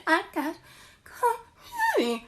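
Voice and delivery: high, slow, breaking whine on chlamydia; drawn-out fake sob on the repeat; mock crying. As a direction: wail it out like a hammed-up sob. Repeating the news, voice cracking.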